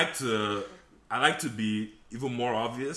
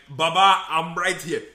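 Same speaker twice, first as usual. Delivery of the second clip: raised, louder protest; exasperated retort; 6 semitones higher, 9 dB louder, slower than usual. Retold or imitated. To the coworker who won't say his name, exasperated. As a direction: call him out loudly, fed up. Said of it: "And I'm like".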